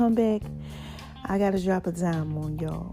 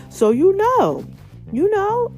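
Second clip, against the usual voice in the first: excited; 10 semitones higher, 6 dB louder, slower than usual. Real, not acted. Excited outburst.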